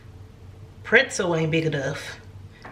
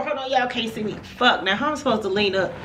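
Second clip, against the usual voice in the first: ordinary delivery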